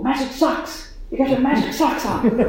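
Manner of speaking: high, laughing call "magic socks"; cheerful crew head. Retold or imitated. Crew head greeting her at the zendo, lightly voiced cheerful call. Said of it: "she had this great phrase".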